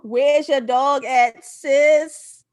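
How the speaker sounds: slow, loud teasing call. Real, not acted. Speaker teasing Dorothy on screen, loud playful call.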